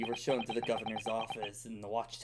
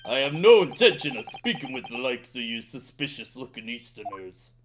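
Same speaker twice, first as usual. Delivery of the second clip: loud, contemptuous jailer; angry governor; 8 dB louder than usual. Acted. Governor ordering the suspicious Easterners out, loud anger. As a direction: drive them out with scorn.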